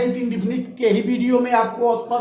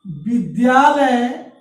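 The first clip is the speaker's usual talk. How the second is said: slow dictation; much slower than usual. Shown as a demonstration. Teacher dictating an example sentence.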